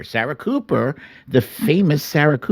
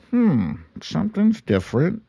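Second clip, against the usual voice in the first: puzzled hmm; 9 semitones higher, 4 dB quieter than usual. Retold or imitated. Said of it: "I was like".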